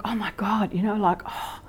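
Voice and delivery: hushed, scandalised whisper; shocked religious whisper. Retold or imitated. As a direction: gasp it out like a pious scold. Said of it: "I would be so religious".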